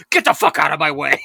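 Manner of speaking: loud, forceful angry shout; retold yell. Passenger storms off past a man boarding, yelling. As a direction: yell it while shoving past someone. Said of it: "He yells".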